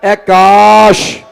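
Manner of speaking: grieving chant of the testament; slow mournful recitation. Acted. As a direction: intone it slowly like a dirge. Arabic testament recited slowly.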